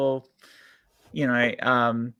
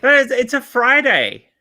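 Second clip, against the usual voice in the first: mock outrage; 10 semitones higher, 6 dB louder, slower than usual. Joking about Don't Fry Day.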